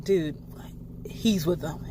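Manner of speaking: quiet, low tip-off; low accusation. Retold or imitated. Ben quietly tells Xander the man is with the mermaids. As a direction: murmur it like a secret. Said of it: "Ben's like".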